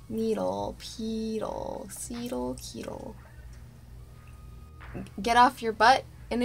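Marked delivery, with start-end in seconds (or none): 0.1-3.1 softer and softer down to a whisper; fading silly rhyme. Real, not acted. Playing with rhymes for needle, fading to a whisper. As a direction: let the nonsense rhyme trail away.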